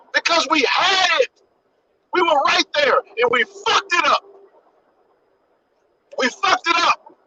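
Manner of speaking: real, worked-up frustration; emotional, frustrated. Real, not acted. Lamenting to viewers that we were right there, emotional. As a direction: hit the regret hard, emotionally. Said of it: "that's why I'm emotional".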